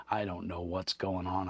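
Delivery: ordinary talk, nothing marked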